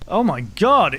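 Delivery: ordinary talk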